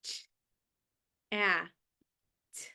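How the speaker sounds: ch, ah, t said as separate sounds with pauses; sounding out words. Shown as a demonstration. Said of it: "Tap each dot and say the sound".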